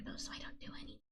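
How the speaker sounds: soft unvoiced ASMR whisper. Real, not acted. Streamer whispering an aside to her chat. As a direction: breathe the words close to the mic.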